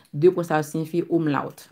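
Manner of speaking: ordinary talk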